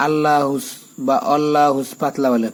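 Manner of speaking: normal talking voice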